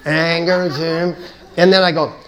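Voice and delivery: building to a loud angry insistence, fast; rising anger. Retold or imitated. Speaker acting out her own angry spiral, loud and fast.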